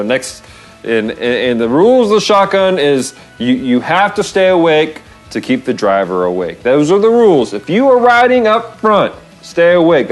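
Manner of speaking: ordinary talk, nothing marked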